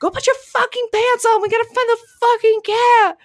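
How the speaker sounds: screaming. Retold or imitated. Wife screaming at husband about the open door. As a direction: scream at him in panic. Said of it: "I screamed so loud".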